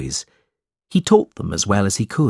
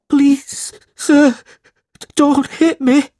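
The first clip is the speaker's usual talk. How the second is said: put-on much higher, louder frightened boy begging; pleading cry; 8 dB louder, slower than usual. A narrator's reading. Smike begs Squeers not to hit him, high and scared. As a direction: cry out in fear of the cane. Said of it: "cried Smike".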